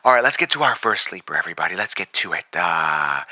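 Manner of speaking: low, deep captain announcing; pilot voice. Acted. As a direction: keep the pilot intercom voice going. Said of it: "I could talk in that voice all day".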